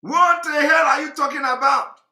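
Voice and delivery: put-on outraged girl. Commentator voicing the girl's angry reaction, mock outrage. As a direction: fire the question back in mock outrage.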